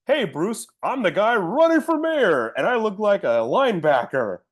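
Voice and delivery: high-pitched hammed-up character voice; mock mayoral candidate. Mayoral candidate introduces himself to Bruce, hammed-up and high. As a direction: send up the character in a swooping high voice.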